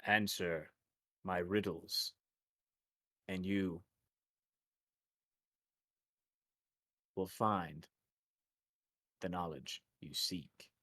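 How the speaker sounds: slow, lightly voiced mysterious riddler; a hint of a cryptic oracle, measured and low-key; riddle-giving voice. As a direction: pose it slowly with long pauses between phrases. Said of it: "The voice says".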